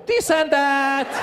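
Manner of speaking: slow, drawn-out evasive answer; vague dodge. Retold or imitated. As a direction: stretch it out, giving nothing away.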